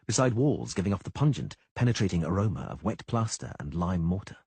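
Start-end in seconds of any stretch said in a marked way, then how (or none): none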